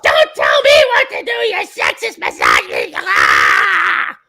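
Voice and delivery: very high shrieking mock outrage; mock outraged shriek. Mocking an offended woman's high-pitched angry shriek.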